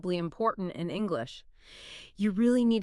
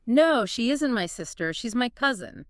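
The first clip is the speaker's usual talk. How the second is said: higher, louder, ISN'T and COUSIN emphasized; stress on ISN'T; 4 semitones higher, 4 dB louder than usual. Shown as a demonstration. Teacher correcting the relationship, stress on ISN'T and COUSIN. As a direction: punch "isn't", then "cousin". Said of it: "Emphasize isn't".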